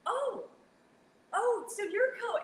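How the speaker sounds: high, slow mock British rock star. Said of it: "and he goes".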